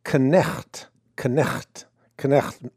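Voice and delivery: Knecht repeated in a Dutch accent, k sounded; Dutch brogue. Shown as a demonstration. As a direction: try Knecht in a Dutch accent, falling away. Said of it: "I'm trying to say it in my Dutch brogue".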